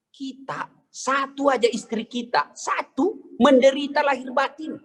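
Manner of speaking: hammed-up comic complaint; mock lament. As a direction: play up the suffering husband. Comic complaint about one wife.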